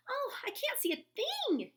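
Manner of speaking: very high, loud panicked yelp. A narrator's reading. Pip yelps in the dark, frightened and high. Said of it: "yelped Pip".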